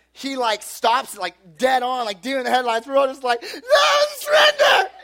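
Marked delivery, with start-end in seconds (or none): 3.4-4.9 very high, loud mock scream; hammed-up shriek of caught kids; panicked surrender. Kids caught in the cop's headlights, panicked high scream.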